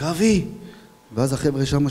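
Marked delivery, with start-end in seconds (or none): 0.0-0.4 a higher call of a friend's name; retold shout. Officer spotting his dead friend, higher shocked call. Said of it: "אני צועק".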